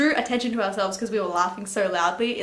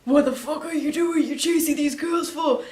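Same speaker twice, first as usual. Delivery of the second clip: confronting stranger; 5 semitones higher than usual. Retold or imitated. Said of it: "he goes".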